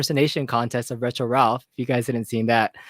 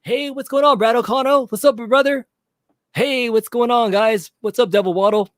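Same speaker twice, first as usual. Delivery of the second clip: much higher, upbeat hype greeting; Michael B impression; 8 semitones higher than usual. Host imitates Michael B greeting his viewers, pitched high. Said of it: "Here's my best Michael B impersonation".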